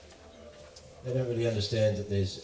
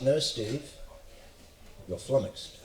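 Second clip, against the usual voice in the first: calm, lightly posh English correction; 4 dB quieter than usual. Retold or imitated. Posh host correcting the ranting Australian, calm posh English. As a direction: drop into composed mock posh English. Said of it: "He has that posh English accent".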